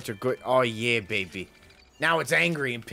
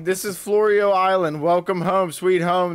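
mock game guide voice; 5 semitones higher, 7 dB louder than usual. Mock-voicing the game's guide character.

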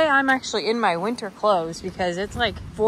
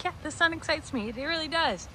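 higher, softer playful voice for the goat; voicing the goat; 6 semitones higher, 6 dB quieter than usual. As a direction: speak the goat's happy thought, high and soft. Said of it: "She's like".

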